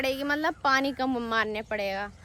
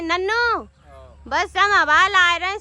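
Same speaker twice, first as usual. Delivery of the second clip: calling out; 6 semitones higher, 7 dB louder than usual. Real, not acted. Calling out to Nannu on roof.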